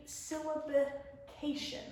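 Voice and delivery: syllabication drawn out in slow parts; broken into syllables. Shown as a demonstration. Teacher introducing a big word, syllabication slowly sounded out.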